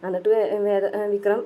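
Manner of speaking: normal talking voice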